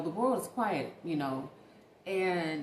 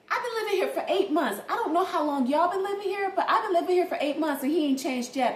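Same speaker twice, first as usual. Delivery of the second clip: louder, higher sassy talking-to; sassy retort; 8 semitones higher, 7 dB louder than usual. Retold or imitated. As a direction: tell her off like a fed-up neighbor. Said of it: "I'm saying to myself".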